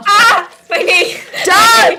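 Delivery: sudden loud cry of pain; pained yelp. Real, not acted. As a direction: yelp out about the knee. Hurt knee, yelping.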